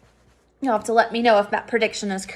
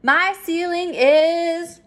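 cloze prompt; 5 semitones higher, slower than usual. Shown as a demonstration. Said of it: "add a suffix that is present tense".

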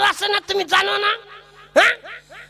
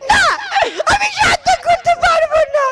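tantrum shout; 8 semitones higher than usual. Acted. Bride refuses the ritual.

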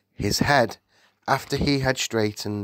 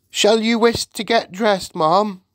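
high, loud servant's query; deferential maid; 12 semitones higher, 6 dB louder than usual. Acted. Maid asks the Queen, high and deferential. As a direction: ask the mistress in a raised voice.

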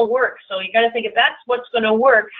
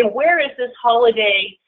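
ordinary talk, nothing marked

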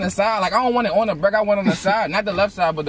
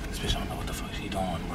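fast hushed aside under breath; whispered aside; 12 semitones lower, 14 dB quieter, faster than usual. Real, not acted. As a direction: mutter it quickly, out of earshot. Quiet aside about the cashier.

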